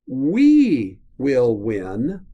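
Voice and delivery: słowo "we" mocno akcentowane, powoli i głośno; stress on we. Shown as a demonstration. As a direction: lean hard on "we", drawn out slowly. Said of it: "but if".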